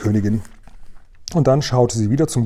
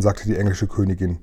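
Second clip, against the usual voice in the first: ordinary delivery, nothing marked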